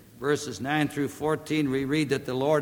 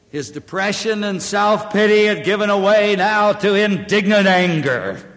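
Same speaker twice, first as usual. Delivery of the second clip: high, loud pulpit voice; full-force preaching; 5 semitones higher, 9 dB louder than usual. Real, not acted. Preacher urging the congregation toward repentance, full force. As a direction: preach it out, driving hard.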